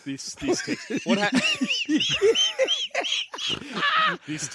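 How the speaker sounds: cracking up, words squeezed out through laughter; can barely get the words out for laughing; laughing at the text. Real, not acted. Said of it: "laughing at text".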